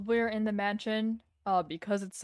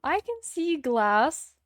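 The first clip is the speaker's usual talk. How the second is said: drawn-out, louder protest; indignant; 6 semitones higher, 5 dB louder, much slower than usual. Acted. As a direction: insist slowly, offended. Insists after being teased.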